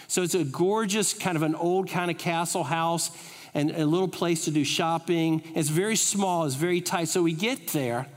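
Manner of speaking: ordinary talk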